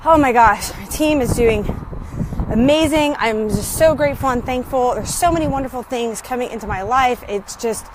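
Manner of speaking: ordinary talk, nothing marked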